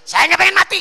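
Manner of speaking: high, loud pleading no; loud mock refusal. A worshipper refusing the angel of death, mock pleading loudly. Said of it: "kalau besok ada Israel".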